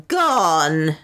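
drawn-out cry of GONE; understated scream of triumph; mild auctioneer's scream. A narrator's reading. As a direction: call it out long to close the sale. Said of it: "screamed Pereira".

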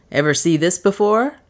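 quick sharp question to the maid; crisp. A narrator's reading. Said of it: "he said crisply".

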